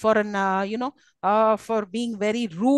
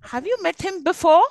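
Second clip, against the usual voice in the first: raised question, "before" louder and rising; standout final word; 6 semitones higher, 5 dB louder than usual. Shown as a demonstration. Teacher giving another example, rising stress on BEFORE. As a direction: push "before" up at the end. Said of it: "what is it which is standing out".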